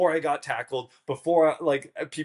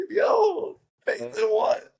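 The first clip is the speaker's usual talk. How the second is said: very high, squeaky disbelief; incredulous; 4 dB louder, slower than usual. Retold or imitated. Contestant replying to have faith, high-pitched incredulity.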